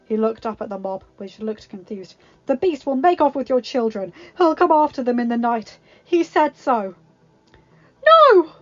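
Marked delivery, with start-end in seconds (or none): 2.5-8.5 loud, driving warning; rabble-rousing hunter. Acted. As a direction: stir the crowd against the beast. Gaston incites the mob.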